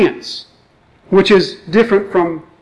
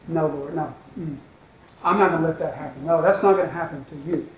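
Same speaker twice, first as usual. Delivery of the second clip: hushed protest; 3 semitones lower, 10 dB quieter than usual. Retold or imitated. Peter refuses Jesus' death.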